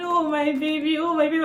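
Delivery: high, loud tearful calling; tearful scream. Retold or imitated. Mother retelling her tearful screaming at the birth, high. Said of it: "I was just screaming".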